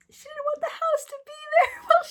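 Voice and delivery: high, squealing laughter through the words; real laughing. Real, not acted. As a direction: crack up mid-sentence, voice shooting up. Breaks up laughing at funniest part.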